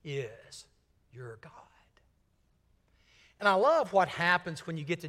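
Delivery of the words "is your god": very soft, low, spaced-out words; slow whisper. Pretend. Jesus speaking God's hidden call, slow soft whisper. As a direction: whisper each word with pauses. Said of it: "And so he whispers".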